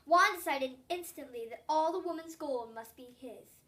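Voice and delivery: plain reading voice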